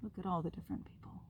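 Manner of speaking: fast, hushed whisper; quick whispered aside over the page. Real, not acted. Quiet aside while showing pictures.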